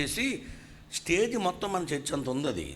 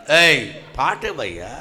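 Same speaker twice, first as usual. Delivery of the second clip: gruff demand; 10 dB louder, slower than usual. Retold or imitated. Demanding the song.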